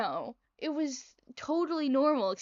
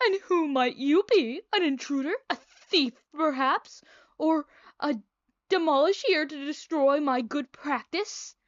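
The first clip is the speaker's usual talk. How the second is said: higher, louder theatrical villain voice; evil-sounding doctor; 5 semitones higher, 5 dB louder than usual. Acted. Evil doctor challenges a suspected intruder at his door, villain voice. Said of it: "my typical evil sounding voice".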